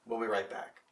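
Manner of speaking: usual speaking voice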